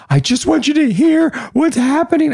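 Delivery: mock panicked wife. Husband mimicking his wife's frantic phone call, high squeaky voice. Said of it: "I go".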